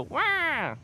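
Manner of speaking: high squealing fake cry to prompt the baby; playful hammed-up wah; mock baby wail. Shown as a demonstration. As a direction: show the baby how to scream. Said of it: "You wanna yell into the microphone? Scream".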